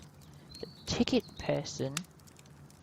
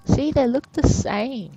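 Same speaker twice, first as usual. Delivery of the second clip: excited discovery; 8 semitones higher, 9 dB louder than usual. Real, not acted. Player spots matching faces.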